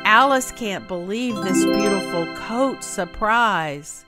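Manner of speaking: ordinary delivery, nothing marked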